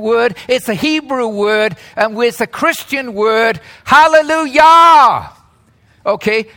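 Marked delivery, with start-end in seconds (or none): 3.8-5.3 proclaimed. Real, not acted. Preacher proclaiming the word.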